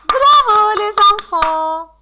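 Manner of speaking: loud, high, ringing cheer; enthusiastic praise. Real, not acted. Teacher congratulating the children on finishing, enthusiastic.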